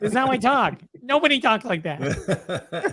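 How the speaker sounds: high comic character, indignant mock retort; odd put-on voice. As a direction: imitate the strange talker, slowing at the end. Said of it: "why are you talking like that".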